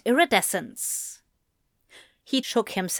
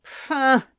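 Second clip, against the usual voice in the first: dismissive snort; 4 semitones higher than usual. A narrator's reading. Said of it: "Anthony snorted".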